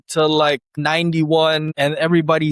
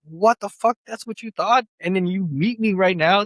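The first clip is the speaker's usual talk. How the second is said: higher, stunned exclamation; shocked disbelief; 7 semitones higher than usual. Retold or imitated. DJ reacting to the gig offer, stunned. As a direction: play your own amazed reaction. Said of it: "And I was like".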